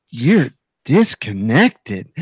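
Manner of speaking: low, drawn-out device announcement; headphone voice prompt. Retold or imitated. Host imitates headphones switching off, slow prompt voice. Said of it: "they go".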